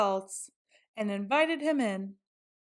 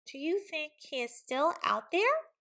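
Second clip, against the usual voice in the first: higher, softer little pig; timid piggy voice; 6 semitones higher, 4 dB quieter than usual. A narrator's reading. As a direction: ask nervously like a small pig. Pig whispers warily.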